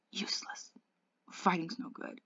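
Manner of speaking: faint, breathless whisper; weak whisper. Acted. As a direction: barely get the words out. Dying Johnny, weak whisper.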